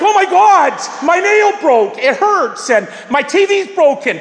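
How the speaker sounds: mock shriek of dismay; mock panic. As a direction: play the girl's mock outrage at a broken nail. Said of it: "you're like".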